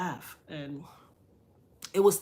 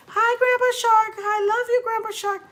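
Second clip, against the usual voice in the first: put-on little girl greeting; 10 dB louder than usual. Granddaughter greeting his ashes.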